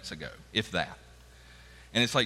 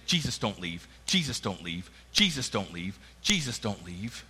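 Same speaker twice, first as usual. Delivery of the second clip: begging; 6 semitones lower, 4 dB quieter than usual. Acted. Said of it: "in their hearts begging".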